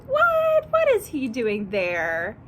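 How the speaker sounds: playful fake shock; mock surprise. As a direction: act shocked for the kids. Gingerbread man at zoo.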